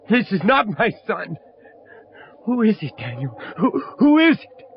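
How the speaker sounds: high, urgent and rising; agitated. Acted. Father demanding to know who lies in the coffin, agitated. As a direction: press him for an answer, shaken.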